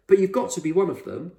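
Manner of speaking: put-on a kid refusing to let it go; insistent boy. Boy insisting explorer is famous.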